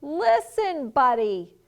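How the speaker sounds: loud, high-pitched call, dropping on the name; yelling. A narrator's reading. Parents trying to get Buddy to listen, yelling. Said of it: "His parents tried yelling".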